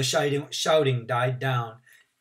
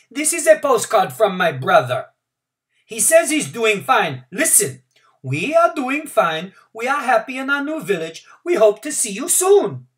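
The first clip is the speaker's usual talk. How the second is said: called out; 10 semitones higher, 9 dB louder than usual. A narrator's reading. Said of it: "someone else called out".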